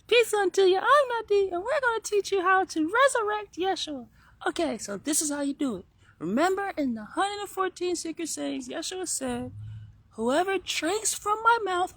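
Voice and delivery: put-on high-pitched, loud little kid voice. Kid teaching viewers how to resurrect Yeshua, high child voice. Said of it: "you just did it in the kid voice".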